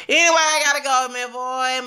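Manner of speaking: high, loud, rushed exit line; excited sign-off. Real, not acted. Vlogger signing off to viewers, high and excited. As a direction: burst out suddenly, high and fast.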